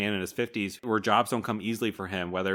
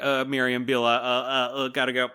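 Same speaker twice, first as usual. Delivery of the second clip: higher, flustered goodbye with stammered uhs; acted embarrassment; 5 semitones higher, 4 dB louder than usual. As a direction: make a mock-awkward hurried excuse to friends. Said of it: "She's, like, embarrassed".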